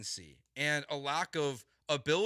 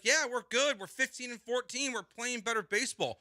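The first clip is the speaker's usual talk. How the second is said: mocking the manager; 9 semitones higher than usual. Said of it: "And to then just be like".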